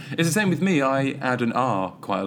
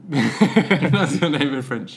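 slow, higher, laughing through words; laughing tease; 4 semitones higher, 5 dB louder, much slower than usual. Real, not acted. Teasing friend about his name, laughing. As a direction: tease him while cracking up.

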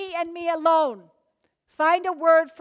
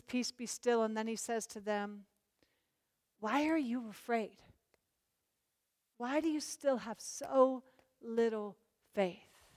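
calm rebuke; 2 semitones lower, 10 dB quieter than usual. Retold or imitated. Jesus calms the storm.

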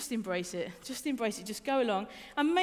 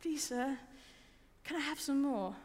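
timid; 2 semitones higher, 6 dB quieter, slower than usual. Retold or imitated. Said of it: "sort of timidly".

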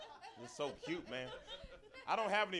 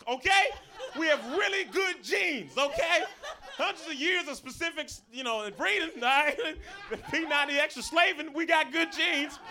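defiant retort; 6 semitones higher, 5 dB louder than usual. Retold or imitated. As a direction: hammer it home to the old lady, raised and emphatic. Shouting back at the old lady.

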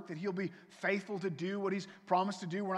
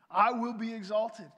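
louder and higher declaration; triumphant God voice; 4 semitones higher, 7 dB louder than usual. Acted. God proclaiming his triumph, loud and sure. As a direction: declare victory with force.

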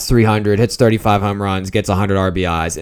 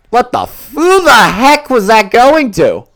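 exasperated outburst; 14 semitones higher, 7 dB louder than usual. Real, not acted. Fan reacting to a terrible pass, exasperated shout. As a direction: yell at the TV in outrage.

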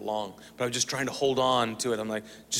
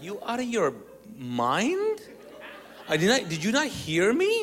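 high-pitched disbelieving retort; incredulous; 7 semitones higher than usual. Retold or imitated. Scared guest balking at his brother's order, incredulous. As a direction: act out outraged disbelief, voice leaping up.

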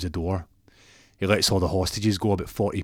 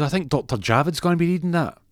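high, louder host voice passing the mic; presenter handing over; 9 semitones higher, 4 dB louder than usual. Retold or imitated. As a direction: hand it off like a TV anchor. Ron hands over to Javid.

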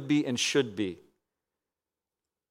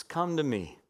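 soft unvoiced whisper; God's whispered call; whispered invitation; 8 dB quieter than usual. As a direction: breathe it like an intimate invitation.

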